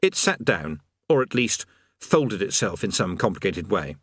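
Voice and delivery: plain reading voice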